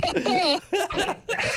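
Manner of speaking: high, slow laughing words. Real, not acted. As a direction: laugh through it. Laughing at Ray's joke.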